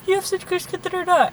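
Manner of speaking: high-pitched mock admirer; gushing compliment, imitated. Quoting people who praised her skin, high mock gushing.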